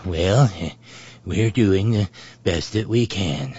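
low, dutiful old-president impression; Ronald Reagan. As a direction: repeat a fed line dutifully, pitched low. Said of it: "Ronnie dutifully looks over and says".

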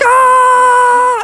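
high loud scream of panic. Acted. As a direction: shriek as the sharks close in. Sharks chasing, they scream.

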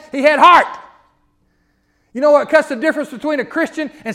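rising to a loud, high preaching peak; full-throated pulpit climax; preaching at full force. Acted. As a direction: build it up and shout it to the congregation.

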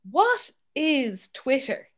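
slow, deliberate baffled question; retold bafflement. Dad asking daughter about Twitter, slowly baffled. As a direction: spell it out slowly, as a puzzled parent.

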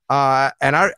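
ordinary talk, nothing marked

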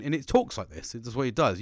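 usual speaking voice

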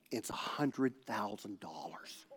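slow, low whisper; hushed reveal. Retold or imitated. Pastor revealing the sum on the opened check, hushed. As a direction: whisper it slowly in awe.

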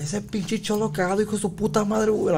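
high, shrill-free mock scolding in Spanish; mock angry Mexican mom; angry Mexican lady. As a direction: play the furious señora cussing him out. Angry Mexican lady voiced in story.

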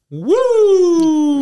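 high, loud wrestling woo; Ric Flair woo. Retold or imitated. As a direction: let out a Flair-style woo. Ric Flair signature whoo.

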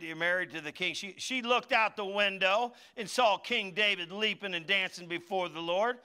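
ordinary delivery

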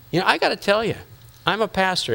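ordinary talk

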